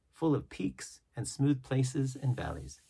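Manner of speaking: usual speaking voice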